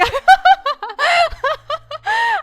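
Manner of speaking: very high, loud burst of laughter; real laugh, loud and way up high; shrieking laugh. Real, not acted. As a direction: lose it laughing. Laughing at own story.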